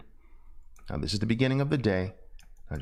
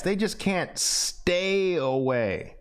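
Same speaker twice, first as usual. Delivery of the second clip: "stay" drawn out slowly and higher; stress on stay; 6 semitones higher, slower than usual. Shown as a demonstration. Teacher drilling the phrase, leaning on stay. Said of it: "you get the point".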